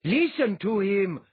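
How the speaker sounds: loud, high call to the crowd; crying out. A narrator's reading. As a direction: urge them on, loud and slow. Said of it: "another cried".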